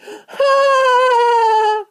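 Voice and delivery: loud, high-pitched laughing fit; peals of laughter. A narrator's reading. Five little men laughing at the children, high peals. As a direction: laugh in a high sustained burst. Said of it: "burst into peals of laughter".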